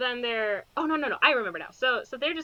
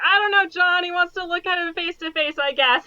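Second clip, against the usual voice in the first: louder, exasperated shrug of an answer; higher, louder sarcastic I don't know; mock exasperated reply; 7 semitones higher, 6 dB louder than usual. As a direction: answer John as if it's obvious nonsense. Her own sarcastic reply retold.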